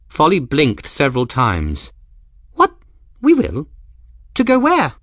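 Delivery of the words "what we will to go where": sharply higher and louder, caught off guard; startled. A narrator's reading. Folly hearing they must leave home, startled and loud. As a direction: blurt it out in disbelief.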